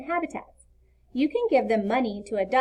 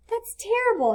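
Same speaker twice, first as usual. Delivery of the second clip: lightly voiced small boy, higher pitch; young boy Leo; 12 semitones higher than usual. A narrator's reading. Leo telling Mom about his lost fort, lightly voiced boy. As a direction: raise the voice into an eager little boy.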